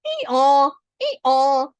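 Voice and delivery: loud, high braying ee-aw; grand donkey bray. Acted. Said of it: "gave a grand".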